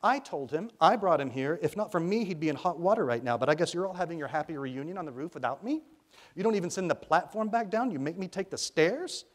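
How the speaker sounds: loud, high indignant tirade; outraged, emphatic scolding; strident, angry. A narrator's reading. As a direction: rail at them for leaving you out. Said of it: "called a strident voice".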